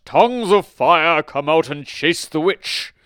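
slow, very loud, high summons; wizard crying out. A narrator's reading. Wizard summoning fire against the witch, loud cry. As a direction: boom out the spell, stretching each word. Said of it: "The wizard cried out".

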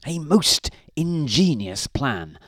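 slow, emphatic approving husband; Mister Grinling. A narrator's reading. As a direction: savour each word as the pleased husband. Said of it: "agreed Mister Grinling".

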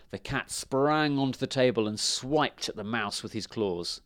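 usual speaking voice

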